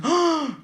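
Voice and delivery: loud, high-pitched gasp; awestruck gasp. Acted. Drunk fan spots Mickey Mouse, awestruck gasp. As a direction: gasp hugely at your idol.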